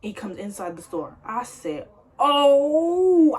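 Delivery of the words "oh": high, loud startled gasp; a sudden frightened "Oh". Retold or imitated. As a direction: jump at the sight of him. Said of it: "I was so scared".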